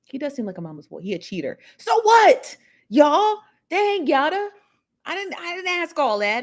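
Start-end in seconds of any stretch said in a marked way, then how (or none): 1.8-6.4 high, loud "so what, y'all"; mock-offended outburst at the chat; exasperated. Real, not acted. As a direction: throw your hands up at the chat, half laughing. Annoyed at chat comment.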